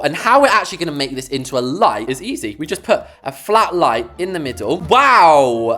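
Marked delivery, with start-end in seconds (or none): 4.8-5.8 louder burst of delight; impressed wow. Real, not acted. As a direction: react to the reveal. Chandelier lights up.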